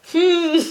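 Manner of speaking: mimicking mum's hum with a laugh; high hum breaking into laughter. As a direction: hum it high, then crack up. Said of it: "she's still laughing and stopping".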